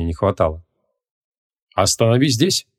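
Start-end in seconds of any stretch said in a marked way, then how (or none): 1.7-2.7 sharp demand. A narrator's reading. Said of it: "резким тоном потребовал Креол".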